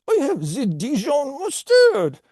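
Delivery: high, loud cartoon French voice; terrible French accent. Potato salad boasting to the storyteller, high bad French accent. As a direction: ham up a squeaky French accent. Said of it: "you have a terrible French accent".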